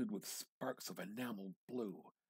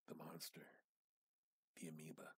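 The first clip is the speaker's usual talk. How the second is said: hushed; 5 semitones lower, 13 dB quieter, faster than usual. A narrator's reading. Narrator names the monster.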